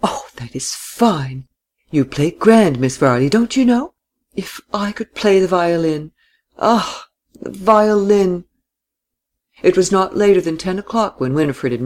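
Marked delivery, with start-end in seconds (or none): 0.0-8.4 gushing, high-pitched rapture over the violin; exclaiming. A narrator's reading. German lady gushing praise at the violinist. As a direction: swoon over the music like a fan. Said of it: "Miss Seyffert exclaimed continually".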